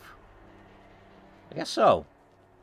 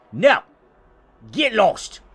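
curt rebuff; 6 semitones higher, 6 dB louder, slower than usual. Acted. Acted neighbor rebuffs caller at door.

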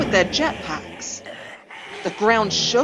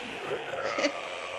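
breathy little unvoiced chuckle; soft chuckle; 6 semitones higher, 11 dB quieter than usual. A narrator's reading. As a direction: chuckle quietly to yourself. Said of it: "The male terrier chuckled".

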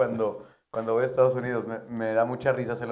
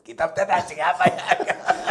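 put-on drunk compadre; 6 semitones higher, 6 dB louder, slower than usual. Drunk norteño compadre voiced.

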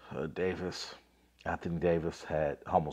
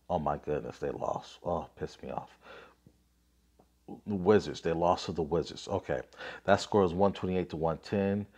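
ordinary talk

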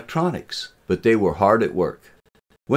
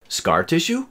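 high, rising tentative question; uncertain; 11 semitones higher than usual. A narrator's reading. Student answering the teacher's question, uncertain. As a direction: offer it as an unsure guess. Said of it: "Brian said uncertainly".

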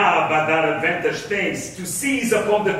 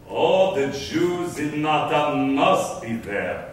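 slow, lower, weighing each word; musing echo; 4 semitones lower, slower than usual. Acted. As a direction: repeat it slowly as if mulling over the news. Pondering the summons he just heard.

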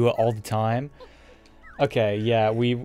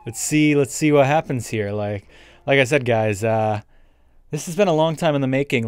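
ordinary delivery

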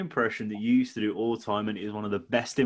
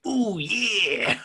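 gruff, higher wrestler growl; Macho Man; 10 semitones higher than usual. Retold or imitated. Imitating his daughter doing the gruff Macho Man catchphrase. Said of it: "She just walks around and goes".

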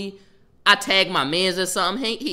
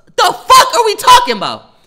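disbelieving yell; 10 semitones higher, 11 dB louder than usual. Retold or imitated. Telling stream how he yelled at the principal, shrieking. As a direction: yell it, much higher and louder, rising to a shriek. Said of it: "I'm, I'm like".

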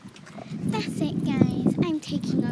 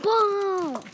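higher, light playful call; cheery call; 7 semitones higher, 4 dB louder than usual. Pretend. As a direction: toss out ball playfully. Says ball again.

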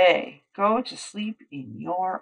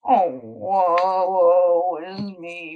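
whickered woe; 7 dB louder, slower than usual. Acted. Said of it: "whickered Horse".

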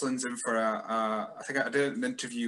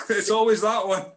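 much higher and louder, laughing along; excited mock recognition; 10 semitones higher, 7 dB louder than usual. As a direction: burst in like an old fan who just remembered. Joining the bit with laughter.

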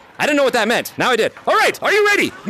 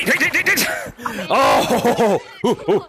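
ordinary talk

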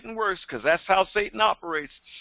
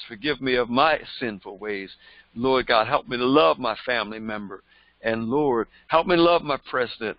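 low, earnest prayer voice; 7 semitones lower than usual. Acted. Preacher modelling a repentant prayer for listeners, low and earnest. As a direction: pray it humbly, lower than the preaching.

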